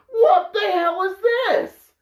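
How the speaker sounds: high, drawn-out cry of disbelief; exasperated, high-pitched mock yell; bewildered shout. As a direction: yell it like a totally lost viewer. Acts out viewer surprised by action.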